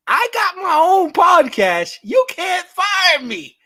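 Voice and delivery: high, loud mock boast; defiant retort. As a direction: shout it slowly like a defiant comeback. Mimicking defiant retort, raised voice.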